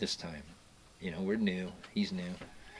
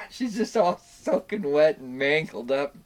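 ordinary talk

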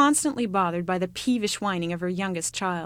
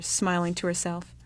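usual speaking voice

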